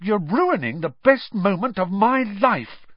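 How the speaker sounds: high, indignant protest; furious. Acted. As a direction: complain in real outrage. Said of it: "said Ron furiously".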